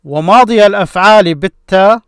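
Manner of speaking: تلاوة بيت الشعر; verse recitation. Shown as a demonstration. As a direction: rattle off the verse line. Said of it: "So this is the meaning of this verse".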